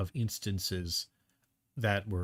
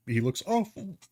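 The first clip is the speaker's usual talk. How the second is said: burping; 10 semitones higher than usual. Real, not acted. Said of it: "Excuse me, I'm burping here".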